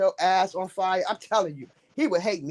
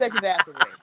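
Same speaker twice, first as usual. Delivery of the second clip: usual speaking voice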